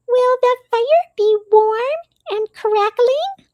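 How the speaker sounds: high, small mouse voice; little Mouse voice. A narrator's reading. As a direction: speak as a tiny gentle mouse. Mouse asks hopefully.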